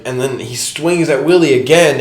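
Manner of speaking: normal talking voice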